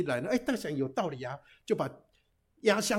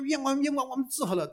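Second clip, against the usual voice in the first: pleading cry; 8 semitones higher than usual. Acted. Brothers cry out before beheading.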